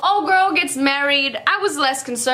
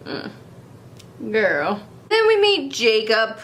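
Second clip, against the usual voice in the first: normal talking voice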